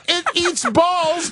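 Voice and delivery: half-laughed mock reply; laughing while quoting; laughing through it. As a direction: giggle the words out. Imagined reply about brisket.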